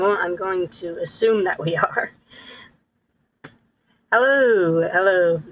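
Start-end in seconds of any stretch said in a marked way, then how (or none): none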